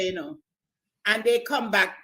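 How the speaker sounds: ordinary delivery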